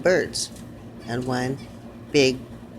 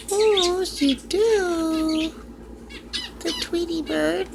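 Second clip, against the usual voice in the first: high, sweet voice cooing at a pet; 12 semitones higher than usual. Real, not acted. Owner calling to her parrot, high cooing baby talk.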